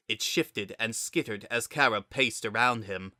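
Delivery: usual speaking voice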